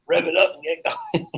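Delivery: amused, breaking into a laugh. Real, not acted. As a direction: say it through a mischievous laugh. Laughing while admitting he teases her.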